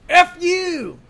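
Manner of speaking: high, loud defiant yell; mock shout. Lecturer playfully shouting the slogan on the character's shirt. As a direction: fling it out like a rude heckler. Voicing the heavyweight guy's shirt.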